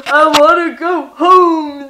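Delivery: put-on loud, slow drawn-out drunk plea; exaggerated boozy moan; drunken wail. Mimics own drunken phone whine.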